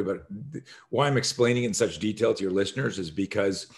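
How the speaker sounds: normal talking voice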